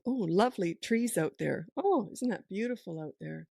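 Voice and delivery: breezy chat to oneself, as if in total control; lightly musing aloud, paying the fuss no attention; unbothered self-talk. Shown as a demonstration. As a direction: carry on your own cheerful narrative. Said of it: "I just talk as if I'm in total control".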